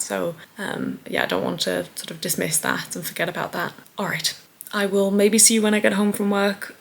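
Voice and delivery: usual speaking voice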